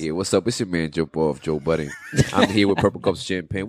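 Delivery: deep, low radio-host voice. Pretend. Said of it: "that shit don't even sound like [name]".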